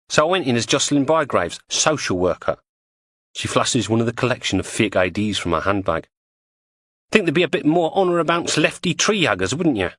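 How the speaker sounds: lightly voiced cockney woman; Julie's voice. Julie describes her fake identity.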